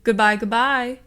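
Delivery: high, loud cheery goodbye; a cheerful AI hollering goodbye; hollered farewell. A narrator's reading. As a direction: holler it like a happy machine. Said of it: "as Basil hollered".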